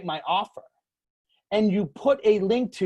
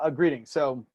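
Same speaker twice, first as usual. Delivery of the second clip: ordinary talk, nothing marked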